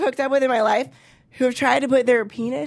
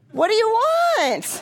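normal talking voice

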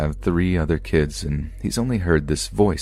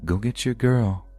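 higher, slow, lilting call; sing-song; 6 semitones higher than usual. A narrator's reading. Dream voice calling to sleeping grandpa, sing-song. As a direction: lightly chant it in a drawn-out sing-song. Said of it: "a sing-song voice say to him".

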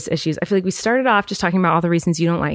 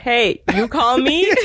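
lightly mimicking the failed prank voice, laughing; slow, giggly echo of a bad prank caller; mocking imitation; 2 semitones higher, slower than usual. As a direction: tease by mimicking, through laughter. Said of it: "I think you said, Hey".

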